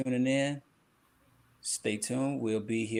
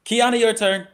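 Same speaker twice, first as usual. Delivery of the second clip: calling out; 11 semitones higher, 11 dB louder than usual. Real, not acted. Host hands over, calling out.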